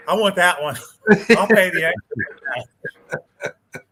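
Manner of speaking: loud and laughing through the words. Real, not acted. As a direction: laugh while insisting on it. Jokingly wants bail coverage.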